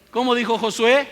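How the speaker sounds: loud rhetorical call. Real, not acted. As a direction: call it out to the congregation. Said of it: "repeated question, loud take".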